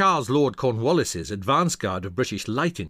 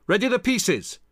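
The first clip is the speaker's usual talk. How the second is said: high-pitched military command; raised commander's voice; shouted order; 10 semitones higher, 3 dB louder than usual. A narrator's reading. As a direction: call out to your gun crew. Hamilton orders his men.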